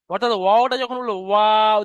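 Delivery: open-lipped Arabic waw; lips apart. Shown as a demonstration. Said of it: "দুটো ঠোঁট ফাঁক থাকছে".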